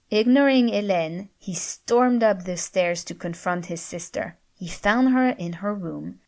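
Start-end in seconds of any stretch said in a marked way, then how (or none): none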